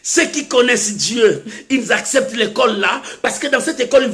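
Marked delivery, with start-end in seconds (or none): none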